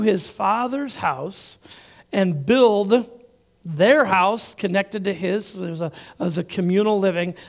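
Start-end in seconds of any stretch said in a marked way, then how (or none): none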